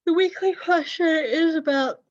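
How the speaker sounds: words stretched through a yawn. Real, not acted. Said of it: "excuse me for a yawn".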